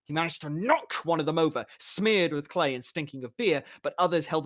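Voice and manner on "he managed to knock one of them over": ordinary delivery, nothing marked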